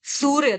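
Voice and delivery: सूर्य with long, high first syllable and closing "rya"; careful Sanskrit pronunciation. Shown as a demonstration. Teacher showing students the Sanskrit word, careful pronunciation of सूर्य. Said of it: "सूरज नहीं, सूर्य".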